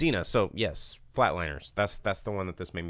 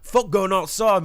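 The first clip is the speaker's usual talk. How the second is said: higher, louder hammed-up Australian accent; mock Australian accent; 10 semitones higher, 7 dB louder than usual. Joking about Aussie kids staying inside, bad Australian accent. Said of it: "I don't know that that was Australia".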